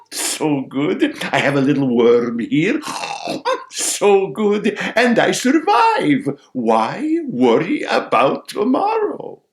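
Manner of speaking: carefree sparrow. Acted. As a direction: relish it as a carefree little bird. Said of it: "says the sparrow".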